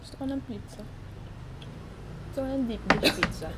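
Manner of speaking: eating while talking; chewing while talking. Real, not acted. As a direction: talk through a mouthful. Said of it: "mahirap magsalita habang kumakain".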